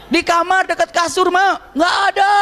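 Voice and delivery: high calling, as if out in a forest; mock family shouting match; yelling across house. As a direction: holler back and forth between rooms. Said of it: "teriak-teriak udah kayak di hutan".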